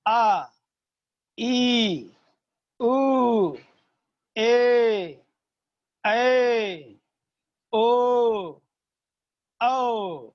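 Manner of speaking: each vowel held for double length; long vowels. Shown as a demonstration. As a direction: stretch every vowel slowly and clearly. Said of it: "दुगुना समय यहाँ देना पड़ेगा".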